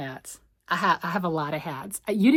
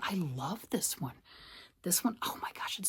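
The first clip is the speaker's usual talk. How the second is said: whisper; 12 dB quieter than usual. Real, not acted. Admiring a favourite knit piece.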